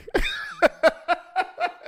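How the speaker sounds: high-pitched burst of laughter. Real, not acted. Laughs at own story.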